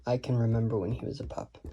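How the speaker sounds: fast, soft and low recitation; quick hushed patter of a verse line; quick, tripping line. Shown as a demonstration. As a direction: skip through it lightly, voice dropped. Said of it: "the second line is difficult to say slow".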